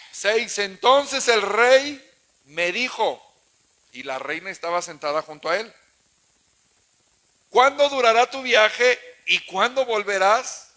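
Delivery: loud, slow scripture reading; loud slow sermon reading; preaching at full force. Acted. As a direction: proclaim the verse to the congregation.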